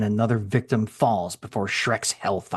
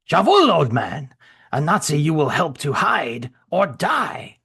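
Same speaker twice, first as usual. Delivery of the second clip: put-on higher, loud German-accented threat; menacing German soldier; 5 semitones higher, 4 dB louder than usual. Shrek threatening the old Frenchman, menacing German-accented voice. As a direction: bark the order like a cornered Nazi soldier.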